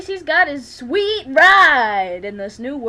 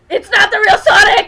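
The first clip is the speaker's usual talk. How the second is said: laughing; 7 semitones higher, 9 dB louder than usual. Real, not acted. Laughing outburst at the fake Sonic.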